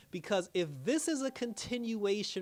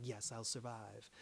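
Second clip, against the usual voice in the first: low, hushed, slow retold vow; subdued promise; 6 semitones lower, 13 dB quieter, slower than usual. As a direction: imitate a soft, solemn promise. Retells Lara's reply to dying Roth.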